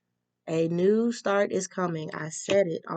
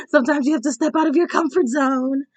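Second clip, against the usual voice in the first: high, loud, rallying; forceful call; 8 semitones higher, 8 dB louder than usual. Shown as a demonstration. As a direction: belt it out like a rally cry. Louder emphatic repeat of the same line.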